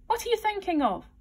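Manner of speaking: high-pitched scolding question; severe. A narrator's reading. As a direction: demand it severely, pitched right up.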